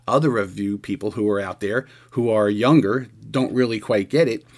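normal talking voice

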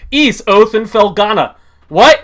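talking while still chewing; words round a bite of food; mouth full. Real, not acted. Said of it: "I didn't finish chewing".

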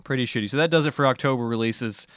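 ordinary talk, nothing marked

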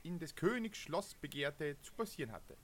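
measured adventure narration; epic fantasy narrator. Acted. Reads the game text aloud. (epischer Fantasy-Erzähler)

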